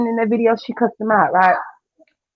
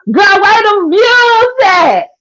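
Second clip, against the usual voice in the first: slow, higher taunting sing-song; mock taunt; 8 semitones higher, slower than usual. Real, not acted. Taunting the rival about her views, slow and high. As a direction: stretch it out to rub it in.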